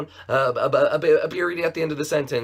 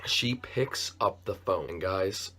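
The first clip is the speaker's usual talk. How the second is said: ordinary talk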